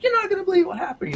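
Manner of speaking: high, fast, bursting with news; can't wait to tell; excited news. Retold or imitated. Calling family with news.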